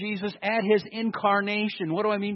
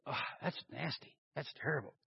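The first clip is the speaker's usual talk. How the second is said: mock disgusted murmur; 6 semitones lower, 16 dB quieter, faster than usual. Shown as a demonstration. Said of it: "and you just go".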